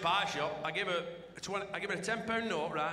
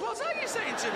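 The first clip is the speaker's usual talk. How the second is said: much higher, incredulous; baffled customer hearing the pie shop lady; 13 semitones higher than usual. Retold or imitated. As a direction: squeak out the disbelief. Baffled reply again.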